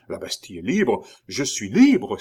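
amazed question building to a loud cry; dawning realisation, loud at the end; exclaiming. A narrator's reading. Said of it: "s'écria le docteur". (étonnement qui monte jusqu'au cri)

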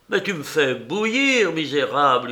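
boiling over, ready to strangle her; furious yell. Acted. Painter yelling at the old woman in fury. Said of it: "hurla le peintre".

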